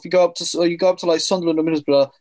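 ordinary delivery

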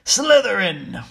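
a restrained scream, loud and slow. A narrator's reading. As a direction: cry it out, stretching the word. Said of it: "when it screamed".

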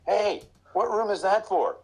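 high, slow, put-on innocent asking; playing dumb with the pizza guy; innocent rookie voice. Rookie sweetly asking the delivery guy which room, mock innocent. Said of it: "and I'd go".

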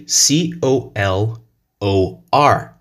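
slow letter-by-letter spelling; slow classroom spelling of each letter. Shown as a demonstration. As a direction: spell C O L O R slowly, one letter at a time. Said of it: "in American English".

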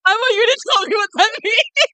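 high, loud, laughing demand. Real, not acted. Friend teasing the other to explain, laughing loudly. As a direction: laugh the words out while pressing for an answer.

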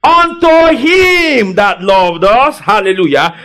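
slow, high, ringing scripture proclamation; full-force preaching. Real, not acted. Preacher leading the church through a verse, fervent high call-and-response. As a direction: stretch the key words and call for answers.

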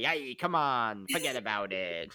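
mock New Jersey mobster, forget about it; hammed-up wise guy refusing the job; goomba. Goomba refusing to move the dowager's piano, in a hammed-up New Jersey mobster voice. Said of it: "Aussie and the Goomba".

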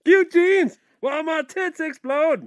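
slow, high, theatrical delivery; hammy stage actor. Acted. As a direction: declaim it like bad Shakespeare. Repeating lyric dramatically.

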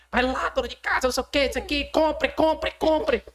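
high, insistent TV shopping pitchman; Ciro Bottini. Retold or imitated. Imitating a Shoptime presenter selling to housewives, frantic sales pitch. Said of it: "o Ciro Bottini".

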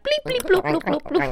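high-pitched alien bleeps; mock Martian. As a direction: bleep and bloop like a silly Martian. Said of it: "do this in Martian".

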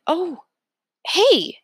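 high, loud and urgent; boy spotting his friend's picture, startled; startled outburst. A narrator's reading. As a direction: blurt it out in sudden alarm. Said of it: "I'm saying".

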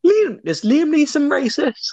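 high-pitched, slow incredulous question; shocked disbelief. Real, not acted. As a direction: stretch it out in real astonishment. Startled at news about the actor.

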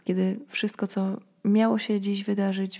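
usual speaking voice

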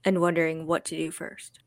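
ordinary talk, nothing marked

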